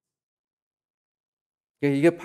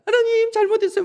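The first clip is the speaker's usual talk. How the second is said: much higher, mock tearful little girl's prayer; mock weepy prayer; 5 dB louder than usual. Schoolgirl mocking a classmate's crying prayer, high and whiny. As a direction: squeak it out like a child faking remorse. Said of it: "막 울면서 기도한다".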